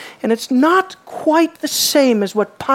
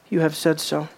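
softer, lower and calm; quiet words; 6 semitones lower, 6 dB quieter than usual. Shown as a demonstration. Jesus answering Pilate, quiet and restrained. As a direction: say it barely above a hush. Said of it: "Such quiet words".

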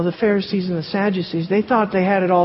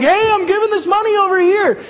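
flaunting the gift, hammed-up and much higher; show-off giver; 11 semitones higher than usual. Preacher mocking hypocrites who flaunt their giving, high showy call. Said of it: "and they're like".

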